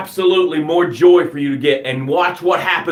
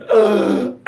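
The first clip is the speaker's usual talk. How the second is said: quick gasp before a cry; baby's gasp; 4 semitones higher than usual. Pretend. A newborn gasping before its first scream. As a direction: suck in air like a newborn about to scream. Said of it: "you're screaming".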